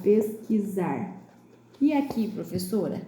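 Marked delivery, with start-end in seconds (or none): 0.0-1.2 pesquisar slowly, S buzzed as Z; S pronounced as Z. Shown as a demonstration. Teacher answering with the written word, slow pesquisar with a voiced Z. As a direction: draw out pesquisar to show the Z in "zar". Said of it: "Tá escrito com Z de zar".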